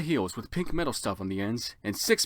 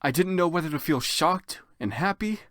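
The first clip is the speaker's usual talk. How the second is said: ordinary delivery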